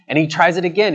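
normal talking voice

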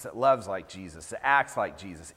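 usual speaking voice

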